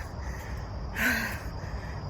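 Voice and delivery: long breathy tired sigh. Real, not acted. Winded sigh after running, to viewers. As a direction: blow it out, spent.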